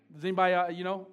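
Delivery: ordinary delivery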